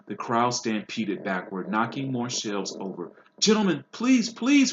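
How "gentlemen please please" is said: high, loud pleading cry. A narrator's reading. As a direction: beg the brawlers to stop. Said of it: "cried the assistant".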